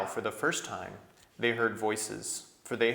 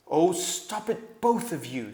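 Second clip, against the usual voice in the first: slow, higher kingly voice; stern king; 8 semitones higher, 7 dB louder than usual. A narrator's reading. Young king rebuking his council, slow and stern. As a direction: weigh each word like a king.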